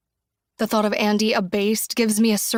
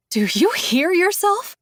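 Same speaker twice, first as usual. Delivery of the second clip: high, wild incredulous; wild disbelief; 9 semitones higher, 3 dB louder than usual. A narrator's reading. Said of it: "a wild laugh bubbling".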